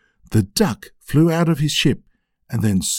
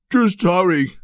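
shouting; 7 semitones higher than usual. Acted. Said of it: "shouted Grandpa Tub".

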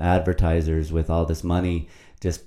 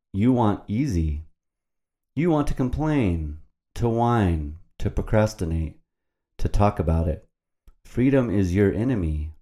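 dramatic poem reading, rising on the lines; poem recital; 3 semitones higher than usual. Acted. Derek recites his freedom poem to listeners, spoken-word style. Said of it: "and now a poem".

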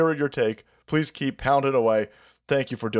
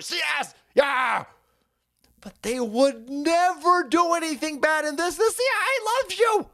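high, sing-song sarcasm, insisting they'd never; sarcastic mock innocence; 6 semitones higher than usual. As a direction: pretend to be a naive CIA fan, mocking it. Sarcastic denial of CIA misdeeds.

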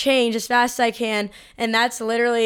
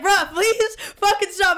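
mock pleading; 7 semitones higher than usual. Begging friend to stop.

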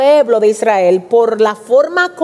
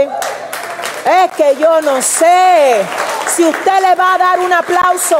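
drawn-out preacher exclamation; 6 semitones higher, slower than usual. Real, not acted. Preacher exclaims, inviting applause.

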